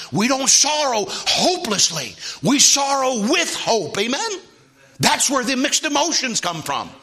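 high, loud, exultant preacher cry; full-force preaching. Real, not acted. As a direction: preach it hard to the congregation.

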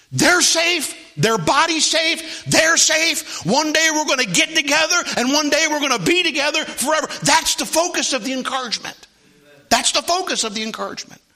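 high, loud, ringing proclamation; full-force preaching. Real, not acted. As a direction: hammer each assurance home to the congregation.

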